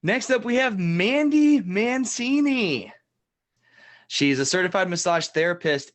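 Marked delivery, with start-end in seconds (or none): 0.0-2.9 drawn-out, higher game-show host announcement; announcer intro. Acted. Host introducing the next celebrity guest, slow showy announcement. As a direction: stretch out the guest's name like a TV host.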